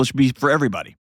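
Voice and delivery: ordinary talk, nothing marked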